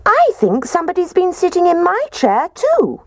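very high, squeaky little bear; squeaking Baby Bear. A narrator's reading. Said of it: "And Baby Bear squeaked".

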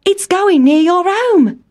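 high excited mouse voice; excited cry. A narrator's reading. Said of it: "cried Town Mouse".

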